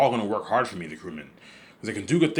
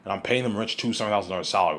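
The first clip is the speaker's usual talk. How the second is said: ordinary delivery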